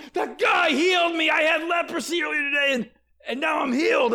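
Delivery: high, loud boisterous shout; healed leper loudly telling everyone about his healing; loud praise. Retold or imitated. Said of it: "he did it in a loud voice".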